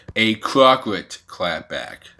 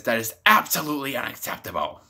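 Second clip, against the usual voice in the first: quick mock outrage; 5 dB quieter, faster than usual. Retelling AOC's outraged objection.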